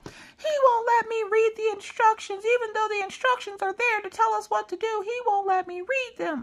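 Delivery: put-on very high-pitched little-girl complaint; whining child. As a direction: mimic a kid whining to a parent. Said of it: "like, whining".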